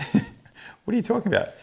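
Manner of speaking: retelling his stunned question to the patient, voice leaping up in disbelief; mock disbelief. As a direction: pitch jumps way up in mock shock. Clinician's shocked reply, retold.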